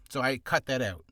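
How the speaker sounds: normal talking voice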